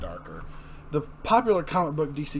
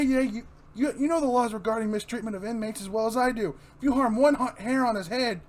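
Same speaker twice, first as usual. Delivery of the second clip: high, loud and desperate; panicked shouting at guards; frantic shouting; 11 semitones higher, 8 dB louder than usual. Acted. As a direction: yell it all in a frenzy. Batman furious, inmate panics.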